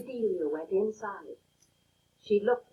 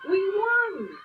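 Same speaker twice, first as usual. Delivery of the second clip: higher triumphant shout; 9 semitones higher, 3 dB louder than usual. A narrator's reading. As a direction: cheer the victory to her. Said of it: "shouted the boys".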